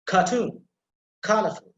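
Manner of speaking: Cartoon and colorful, each stressed on the first syllable. Shown as a demonstration. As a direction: punch CAR in Cartoon and COL in colorful. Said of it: "put emphasis on the first syllable".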